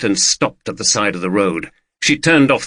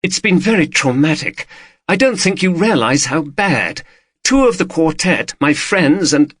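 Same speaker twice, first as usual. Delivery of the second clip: higher, shaken woman's voice; wife confiding her fear, higher; frightened wife; 6 semitones higher than usual. A narrator's reading. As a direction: play her scared and upset. Female character voice.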